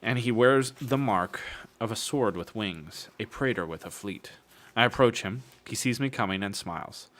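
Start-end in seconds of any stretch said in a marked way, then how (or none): none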